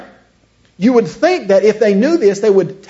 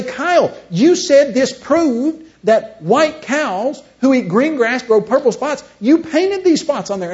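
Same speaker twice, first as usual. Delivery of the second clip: high, drawn-out acted protest; mock indignant accusation; 6 semitones higher, slower than usual. Duped friend confronts Kyle about painted cows, mock indignant. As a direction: stretch out the accusation in a raised voice.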